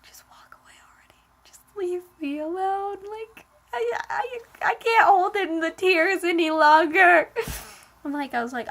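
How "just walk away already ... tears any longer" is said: tearful whisper rising into a mock wail; trying not to cry. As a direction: act out holding back tears, then break high and loud. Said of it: "I was trying not to cry".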